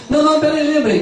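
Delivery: high, quick burst of recall; sudden remembering. Acted. Sinner suddenly remembering a good deed, excited and high. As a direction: blurt it out as it comes back.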